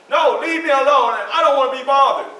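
put-on upset small boy refusing to be bothered; whining like a child. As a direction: throw a little boy's tantrum. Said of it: "as a child would say".

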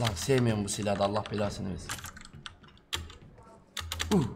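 normal talking voice

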